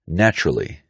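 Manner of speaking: "Naturally" shortened to NATCH-ruh-lee; omitted syllable. Shown as a demonstration. Teacher showing omitted syllables, "Naturally" as NATCH-ruh-lee. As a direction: drop the second vowel of "Naturally". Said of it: "Omitted syllables".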